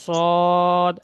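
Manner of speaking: normal talking voice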